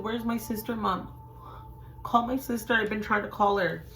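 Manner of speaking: high-pitched, urgent plea to mom; pleading. Retold or imitated. Daughter begging mom to call sister, pleading. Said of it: "And I'm pleading with her".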